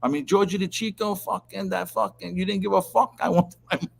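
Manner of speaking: high mock scolding boss; hammed-up furious rant about money; imitating the boss's rant, pitched high; retold angry scolding. Boss scolding over debt.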